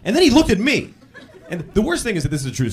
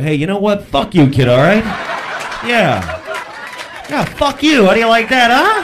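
loud comic telling-off, building higher; mock fed-up parent; 5 semitones higher, 6 dB louder than usual. Parent finally cursing at the whiny kid, mock angry. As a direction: play a parent losing patience with relish.

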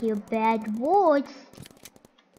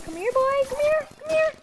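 high excited call; 13 semitones higher, faster than usual. Real, not acted. Calling the target, rising pitch.

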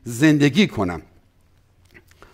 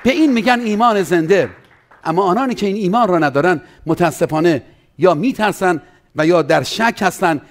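usual speaking voice

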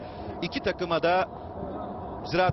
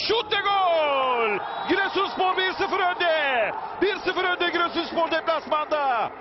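screaming football commentator; 8 semitones higher than usual. Real, not acted. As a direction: belt out the goal call. Goal call in live commentary.

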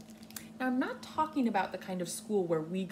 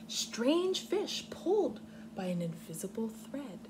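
slow, expressive storybook reading; reading to children; 4 semitones higher than usual. Acted. Teacher reading Swimmy aloud to her class, expressive storybook voice. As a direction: read it gently like a storybook. Reads picture book aloud.

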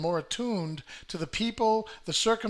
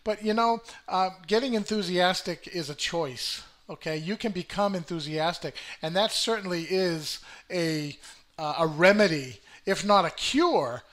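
ordinary delivery

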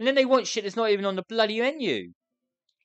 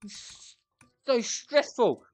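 usual speaking voice

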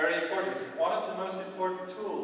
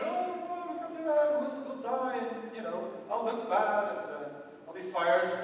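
much higher, mock panic; panicked manager; 4 semitones higher than usual. Manager imagining he will be fired, pitched-up mock panic. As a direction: squeal it out like a boss in a panic. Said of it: "But he said".

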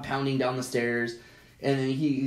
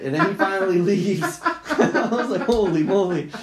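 louder, words breaking into real laughter; laughing; 4 semitones higher, 6 dB louder than usual. Real, not acted. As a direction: tell it through laughs, voice lifted. Laughs while ending the story.

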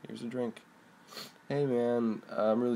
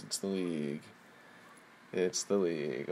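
low hushed trailer voice; ominous narrator; 6 semitones lower, 7 dB quieter than usual. Acted. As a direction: murmur it like a dark reveal. Dramatic ominous repeat, lower softer.

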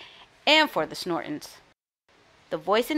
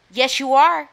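louder, higher taunting comeback; yelled retort; 5 semitones higher, 6 dB louder than usual. A narrator's reading. Sister contradicting her brother, yelling back. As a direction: yell back at a bickering sibling. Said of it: "she yelled back".

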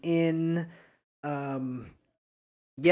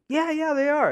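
cocky; 13 semitones higher, 6 dB louder than usual. Retold or imitated. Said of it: "And I'm like".

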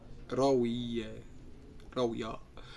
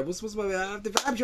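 mocking husband; 8 semitones higher, slower than usual. Mocking husband jeering at wife.

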